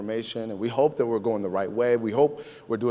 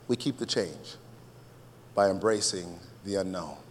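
"We keep the change" soft, pause, then "by embracing the unknown" lower; soft, measured statement; 5 semitones lower, 6 dB quieter than usual. Shown as a demonstration. Preacher giving congregation his main line, soft and measured.

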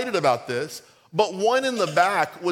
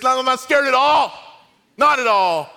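put-on loud, high little-kid denial; high, indignant kid yelling a denial; defiant shout; 7 semitones higher, 7 dB louder than usual. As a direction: shout it back, insisting you're not scared. Son's defiant answer to girl.

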